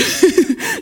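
high, loud laugh; a short bright laugh; burst of laughter. Real, not acted. Laughing at the U-turn.

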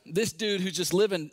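ordinary talk, nothing marked

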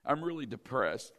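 low, soft mock-sad voice; hammed-up gloom. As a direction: fake a droopy sad voice. Preacher mocks faking sadness.

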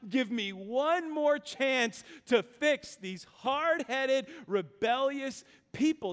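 high, slow, emphatic plea; pleading. Acted. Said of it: "Moses pleads and he says".

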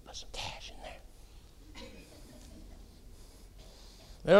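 very soft, slow whisper; whispered aside. Acted. Mock casual Jesus asking a dude for cash, whispered. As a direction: slip it in under your breath.

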